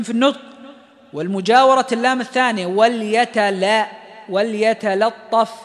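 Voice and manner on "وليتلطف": وليتلطف slow, lam thin before the heavy ta; light lam. Shown as a demonstration. Teacher drilling وليتلطف for students, slow light lam. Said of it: "لمجاورة الياء الثانية للطاء".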